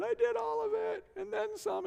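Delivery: very high, hammed-up wail; exaggerated squeaky tearful voice; mock crying confession. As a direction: blubber out a confession in comic sobs. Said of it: "I cried really hard".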